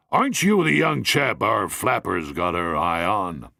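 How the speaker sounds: a gruff probing question; older gent, suspicious. Acted.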